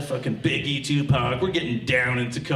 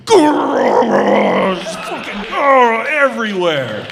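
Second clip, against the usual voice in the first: long, very loud comic eruption; explosive climax noise; 6 semitones higher, 9 dB louder, much slower than usual. Acted. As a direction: blast out a drawn-out gushing roar.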